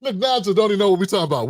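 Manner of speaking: normal talking voice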